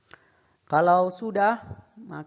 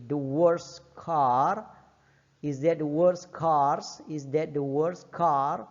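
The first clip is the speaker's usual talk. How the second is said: slow model reading; much slower than usual. Shown as a demonstration. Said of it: "teacher drills phrase slowly".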